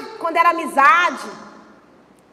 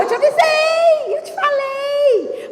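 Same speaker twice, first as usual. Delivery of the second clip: higher, slow, drawn-out gloating told-you-so; mãe jogando na cara; 9 semitones higher, slower than usual. Retold or imitated. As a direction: sing it out slowly, rubbing it in. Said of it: "ela me vira e fala". (mãe cantando "eu te avisei" bem alto e arrastado)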